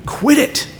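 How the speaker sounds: high, sharp command to the storm; a high, stern voice of Jesus rebuking the wind; stern rebuke. Acted.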